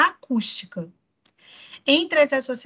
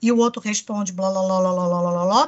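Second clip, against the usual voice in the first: babble imitation; 5 semitones lower than usual. Shown as a demonstration. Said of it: "que fica blá".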